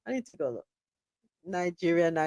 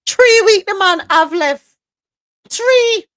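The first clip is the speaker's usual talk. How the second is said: put-on high, loud Jamaican-accented outburst; mock Jamaican patois; 11 semitones higher, 9 dB louder than usual. Newsreader reacting to the three weeks left, loud mock Jamaican accent.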